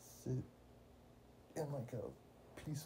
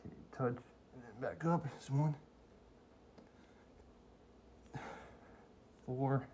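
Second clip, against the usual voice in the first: counting mid push-up; strained counting between reps; doing push ups; 7 dB quieter than usual. Real, not acted. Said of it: "I'm losing momentum".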